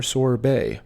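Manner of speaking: sherbet said sor-BAY; common mispronunciation. Shown as a demonstration. Teacher showing viewers a wrong way. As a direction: say sherbet like sorbet. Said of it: "often mispronounced as sorbet or".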